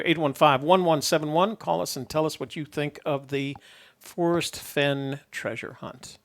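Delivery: ordinary talk, nothing marked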